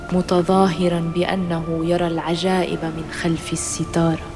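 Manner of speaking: ordinary delivery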